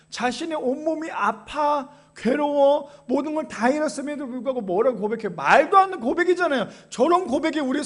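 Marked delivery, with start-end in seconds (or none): none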